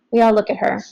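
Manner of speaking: ordinary delivery, nothing marked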